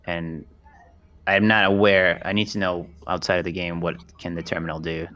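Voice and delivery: normal talking voice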